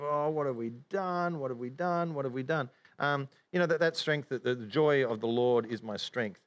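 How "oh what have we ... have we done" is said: wallowing in sorrow. Acted. Preacher imitating people living in sorrow, mock wailing. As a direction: moan it over and over, hammed-up. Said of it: "we're just living in the".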